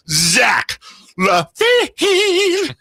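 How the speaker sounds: slow, high, shouted "Zach LaVine" with a wobble; hammed-up announcer. Mock pitch for a new intro, high slow announcer shout. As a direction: stretch it out like a goofy arena call. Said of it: "That's Dave's version".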